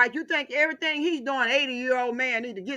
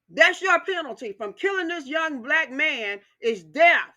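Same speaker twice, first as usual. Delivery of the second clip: usual speaking voice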